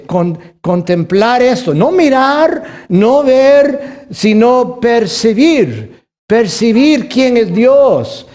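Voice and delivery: high, slow, loud pulpit delivery, stretched out; fervent preaching. Acted. Pastor to the congregation, slow loud preaching. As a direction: preach it, drawing out each phrase. Preacher quoting scripture with force.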